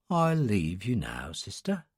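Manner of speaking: put-on soft, hushed old attendant; a sleepy old man whispering politely; quiet old man voice.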